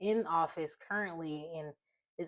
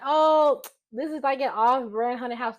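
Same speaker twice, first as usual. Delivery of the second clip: amused excitement; 5 semitones higher, 8 dB louder than usual. Retold or imitated. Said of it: "So I'm thinking".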